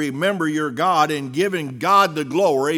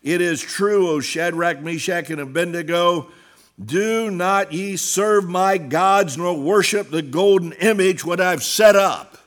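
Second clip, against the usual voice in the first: sermon scripture reading; 2 semitones higher, 4 dB louder than usual. Acted. Preacher reading the king's demand to the three. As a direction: read it out like a preacher quoting the king.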